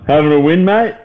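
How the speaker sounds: mock bloke. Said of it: "another good one".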